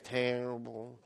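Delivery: low, soft, slow gloomy moan; mopey sulker droning low; mopey person. Acted. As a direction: sink into a deep glum mumble. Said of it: "You ever see a mope person?".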